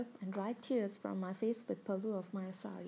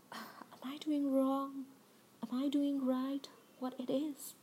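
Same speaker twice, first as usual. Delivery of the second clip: anxious self-doubt; 5 semitones higher, slower than usual. Acted. Narrator's anxious inner doubt.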